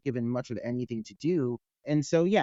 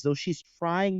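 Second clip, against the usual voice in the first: usual speaking voice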